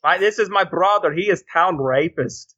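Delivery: put-on Borat accent. Borat imitation continues.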